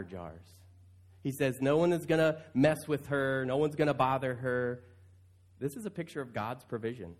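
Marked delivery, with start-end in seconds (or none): none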